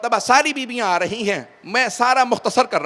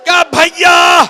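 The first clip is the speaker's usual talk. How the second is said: lamenting preacher; 7 semitones higher, 10 dB louder than usual. Acted. Preacher lamenting the mounting of the women to the weeping congregation, loud wailing recitation. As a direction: pour grief over the mourners at full force.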